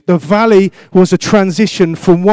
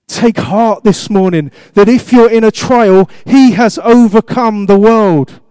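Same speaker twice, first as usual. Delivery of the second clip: slow, loud, raised preaching; preaching at full force; 4 semitones higher, 4 dB louder, slower than usual. Acted. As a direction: proclaim it slowly to the congregation. Preaching climax.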